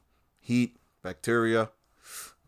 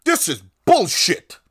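slow, higher, louder mock outrage on "This is bullshit"; a mock cable anchor, slow and loud; mock pundit outrage; 7 semitones higher, 6 dB louder, slower than usual. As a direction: ham up a newsman's fake anger. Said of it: "and he's like".

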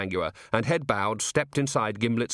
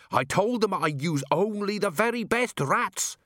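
indignant shout; 10 semitones higher than usual. A narrator's reading. Dwarf cook defends his rats to the watch, indignant shout. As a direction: insist on it in a high mock shout. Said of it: "shouted Gimlet".

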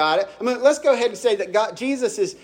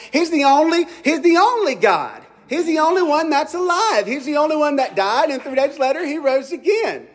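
higher, ringing proclamation of the only God; preaching; 7 semitones higher than usual. Acted. Pastor proclaiming to the congregation, rising preaching. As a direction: lift the congregation with conviction.

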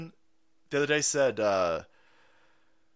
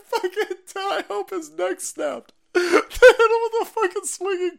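laughing high; 6 dB louder than usual. Real, not acted.

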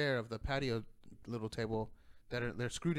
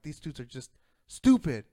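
much louder, fed-up blast; yelling; 2 semitones higher, 11 dB louder than usual. Retold or imitated. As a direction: yell it at the stupid crowd. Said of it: "I'm yelling".

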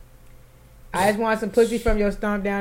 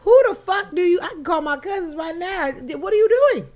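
outraged; 10 semitones higher than usual. Real, not acted. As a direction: blow up at him in disbelief. Outraged rant at the man.

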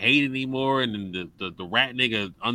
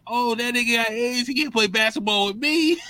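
mock townsperson; 11 semitones higher than usual. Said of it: "It's like".

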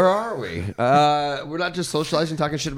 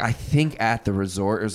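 ordinary talk, nothing marked

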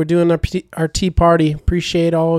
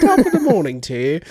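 mock Irish accent; 3 semitones higher than usual. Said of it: "the accents are gonna be coming strong".

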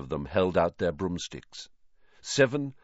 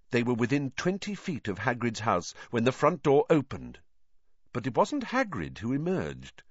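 usual speaking voice

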